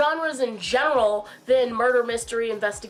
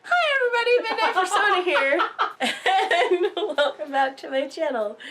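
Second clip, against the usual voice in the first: high intro through a laugh; giggly retake of the channel intro; laughing greeting; 6 semitones higher than usual. Shown as a demonstration. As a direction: greet them while cracking up.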